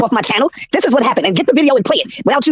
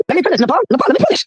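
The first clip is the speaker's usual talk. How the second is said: much higher exasperated call; rising frustrated plea; pleading insistence; 5 semitones higher, faster than usual. Real, not acted. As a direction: demand the floor from his wife. Cut off, insisting to speak.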